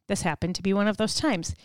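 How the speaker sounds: normal talking voice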